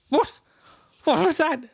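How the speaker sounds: high startled blurt. Acted. Drunk lord startled by a flying cup. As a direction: jolt awake and squawk.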